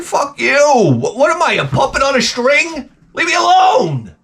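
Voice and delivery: fed up, yelling at a woman who keeps pushing him to dance; angry outburst. Retold or imitated. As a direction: snap back at her, building into a mock cry-shout.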